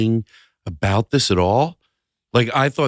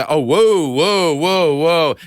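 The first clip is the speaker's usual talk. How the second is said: louder, high whoas of mock alarm; 4 semitones higher, 4 dB louder, much slower than usual. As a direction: stop them like a scandalised chaperone. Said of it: "say a word that's got a bad word".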